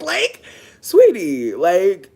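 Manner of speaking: slow, loud drawn-out "sweetie"; condescending. Real, not acted. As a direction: stretch it out, loud and scornful. Scornful reply to moving out.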